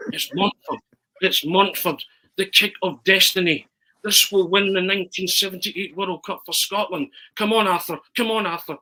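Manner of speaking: high, loud commentator voice, rising excitement; self-commentary. Retold or imitated. Arthur commentating on his own penalty at the empty Maracana, excited. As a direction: cheer yourself on like a TV commentator. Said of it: "So I start commentating on myself".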